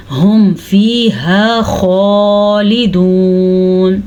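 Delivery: slow chanted tajweed, throat kha; slow recitation. Shown as a demonstration. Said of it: "खौ का जो मखरज है". (धीमी तिलावत, हलक से खौ)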